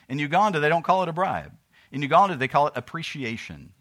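fiery sermon voice; preaching at full force. Acted. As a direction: thunder it from the pulpit.

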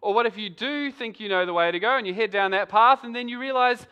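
ordinary talk

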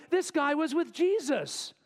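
high, drawn-out announcement to a crowd; public accusation. Acted. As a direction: accuse him for everybody to hear. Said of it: "She says to everybody".

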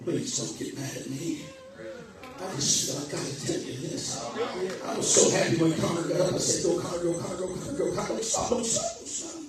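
soft, low confiding aside; hushed aside. Real, not acted. Preacher to church, quiet confession.

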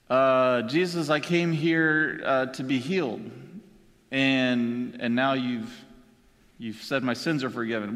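low, halting, hesitant reply; puzzled paralyzed man. Pretend. Paralyzed man who came to be healed, puzzled and halting.

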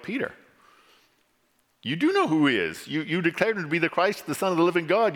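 high-pitched, pleading disbelief; incredulous rebuke. Acted. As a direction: remonstrate with a friend who denies what he knows. Preacher rebukes Peter in disbelief.

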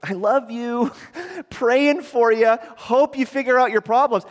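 sing-song 'praying for you' while doing nothing; mock dismissive piety. Preacher mocking a believer who brushes off one in need, airy and dismissive. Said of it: "If you see a brother or sister in need and you go".

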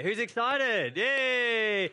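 high, loud cheerleader call; hyping crowd. Real, not acted. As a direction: whip the congregation into excitement. Hyping the congregation.

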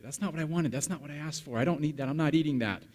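put-on high-pitched whiny kid protest; ungrateful child. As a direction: imitate a small child refusing dinner. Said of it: "ungrateful the moment it hits the plate".

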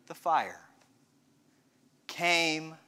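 dropping soft and low, with long pauses; hushed. Acted. Preacher leading up to the gentle whisper, hushed. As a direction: let the voice sink slowly towards silence.

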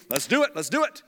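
eager, punchy urging; gung-ho enthusiasm; young Moses. Acted. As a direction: repeat it keenly like a zealous youth. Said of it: "Before he'd say".

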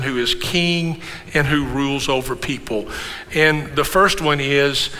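usual speaking voice

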